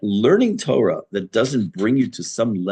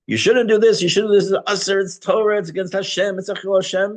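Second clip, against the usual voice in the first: much higher and louder, a sanctimonious scolding voice; mock holier-than-thou sermon, pitched way up and loud; preachy hypocrite; 10 semitones higher, 4 dB louder than usual. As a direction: lecture everyone else from a high horse. Mocking preachy hypocrites on the news.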